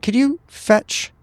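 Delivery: ordinary talk, nothing marked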